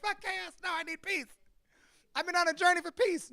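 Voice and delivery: high-pitched exasperated objection; frantic protest. Retold or imitated. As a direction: retell it as a squealing complaint. Retold frantic protest against chaos.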